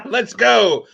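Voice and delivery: hyped up. Real, not acted. Hosting call to fight.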